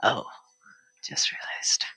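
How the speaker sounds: normal talking voice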